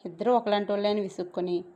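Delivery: ordinary delivery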